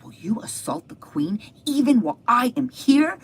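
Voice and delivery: loud, high, building indignation; outraged. Retold or imitated. King catches Haman on the queen's couch, loudly outraged.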